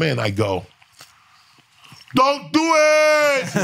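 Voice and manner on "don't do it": high, slow, stretched-out "Don't do it!"; slow, high mock warning cry; drawn-out warning yell. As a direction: howl it across the dining hall. Warning inmates off the kitchen food.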